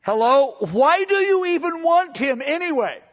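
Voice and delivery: exasperated second asking; pressing the question. Retold or imitated. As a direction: push the question harder. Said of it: "they ask it twice".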